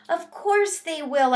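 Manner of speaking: high squeaky little hamster voice; hamster squeak. A narrator's reading. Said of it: "I squeaked".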